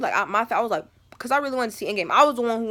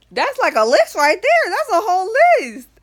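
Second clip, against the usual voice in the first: exaggerated protest; 10 semitones higher, 9 dB louder than usual. Daughter protests mom's chores, high loud mock outrage.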